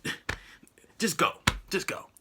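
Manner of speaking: mildly exasperated, giving up; frustrated. Retold or imitated. Frustrated instructor to the driver, weary exasperation. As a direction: wave the driver on, fed up. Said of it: "He getting frustrated".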